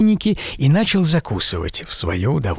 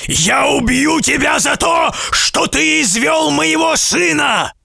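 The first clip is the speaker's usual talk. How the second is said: high, slow shouting threat; furious giant threat. A narrator's reading. Giant accusing the merchant of killing his son, furious shouting. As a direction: hurl the accusation at him. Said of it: "вскричал великан страшным голосом".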